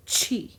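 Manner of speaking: "chi" said chee. Shown as a demonstration. Teacher explains C before I, "chi" with CH. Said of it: "like a C H".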